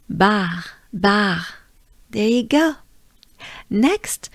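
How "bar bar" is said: "Bar" in French on a good breath; French pronunciation. Shown as a demonstration. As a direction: lead learners in "bar" with French throat R. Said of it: "We take a good breath".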